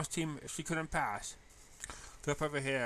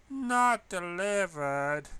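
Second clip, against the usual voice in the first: slow, drawn-out annoyed grumble; grumbling; 6 semitones higher, 7 dB louder, much slower than usual. Acted. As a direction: drag out the disappointment. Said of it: "she grumbled".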